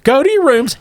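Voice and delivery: put-on high-pitched mom sending kids off; Gamorrean mother. Mother to her rowdy kids, high character voice. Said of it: "There's three Gamorrean kids running around".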